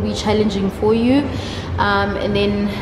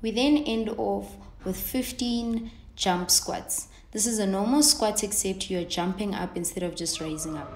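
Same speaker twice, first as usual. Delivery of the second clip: voiceover read; 11 dB quieter than usual. Acted. Recorded voiceover instructions.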